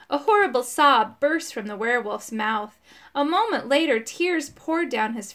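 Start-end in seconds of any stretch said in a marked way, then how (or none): none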